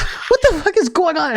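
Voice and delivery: high-pitched mock bewilderment; alarmed, high disbelief; panicked confusion. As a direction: act woken into chaos. Woken son is bewildered.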